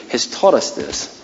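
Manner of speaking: usual speaking voice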